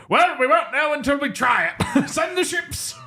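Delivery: very high, blustering cartoon British general; Montgomery caricature. Montgomery brushing off the warning, high silly caricature. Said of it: "And Montgomery's like".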